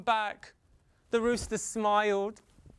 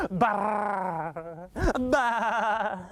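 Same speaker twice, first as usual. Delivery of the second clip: sheep bleating. Acted. Sheep bleating up at the sun.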